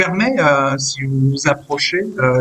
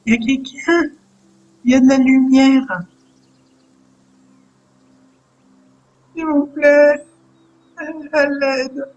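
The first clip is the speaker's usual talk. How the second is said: put-on high child's voice calling for help; frightened caged child; 9 semitones higher than usual. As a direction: plead haltingly, like a small captive child. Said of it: "Vous entendez une voix". (voix aiguë d'enfant captif qui appelle à l'aide)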